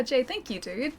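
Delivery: ordinary talk, nothing marked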